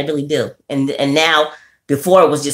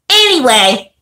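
higher, louder brush-off anyway; Anyway punched higher to change the subject; emphatic reset; 6 semitones higher, 4 dB louder, slower than usual. Shown as a demonstration. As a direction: shake it off and say Anyway loud and bright. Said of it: "but anyway".

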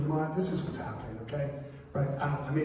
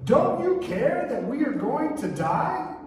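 panicked plea; 10 semitones higher, 3 dB louder, slower than usual. Retold or imitated. Frightened disciples wake Jesus.

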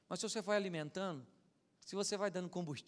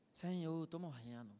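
much softer, lower, fading; faint, low voice of a dying man; weak dying plea; 3 semitones lower, 7 dB quieter than usual. Retold or imitated. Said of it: "dying man's prayer".

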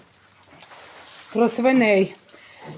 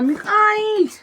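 put-on much higher "Михалич" in a small girl's voice; "Михалич" mimicked as the daughter says it, much higher; child's way; 13 semitones higher, 5 dB louder, slower than usual. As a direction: copy the toddler echoing the call. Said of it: "Якось там по-своєму".

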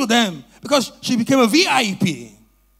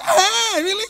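mock amazement; 9 semitones higher, 3 dB louder, slower than usual. Feigned amazement.

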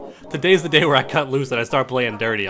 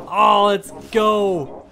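higher, slow savoring cheers; gloating celebration; 6 semitones higher, slower than usual. Real, not acted. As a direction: gloat and stretch every word. Double rage quit victory.

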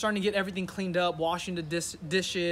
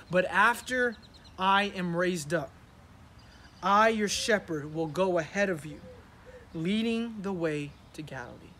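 scripture reading. Acted. Pastor reading Jesus's promise to the church, earnest. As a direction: read the verse out with weight.

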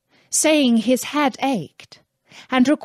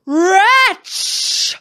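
a furious genie cries out, much higher and louder; genie's angry cry; 10 semitones higher, 6 dB louder than usual. Acted. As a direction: roar it out as the enraged genie. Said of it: "he cried".

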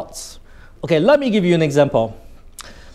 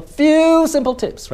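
few held very long and high; elongated Few; 12 semitones higher, slower than usual. Shown as a demonstration. Teacher showing students emphasis, few high and greatly prolonged. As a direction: stretch out few, then drop through "simple tips". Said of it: "you, you, you prolong it".

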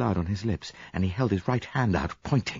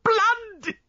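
piercingly high, loud scream of horror; hysterical cry; 4 dB louder than usual. A narrator's reading. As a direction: shriek the word in hysteria. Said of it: "she cried hysterically".

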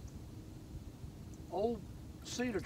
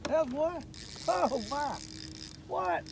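surprised; 7 semitones higher, slower than usual. Real, not acted. Surprise catch on the line.

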